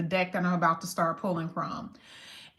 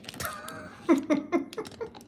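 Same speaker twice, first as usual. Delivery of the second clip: high giggle at own mishap; real, amused fit of giggles; helpless laugh; 9 semitones higher, 3 dB quieter than usual. Real, not acted. Laughing at her own shuffling mishap.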